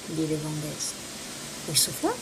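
ordinary delivery

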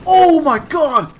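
drawn-out high yell; startled scream. Real, not acted. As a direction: scream it in shock. Sudden scare in game.

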